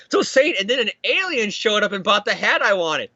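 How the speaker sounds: high, exaggerated tall tale; attention-seeking storytelling. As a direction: pitch it up to catch her attention. May invents tale to get attention.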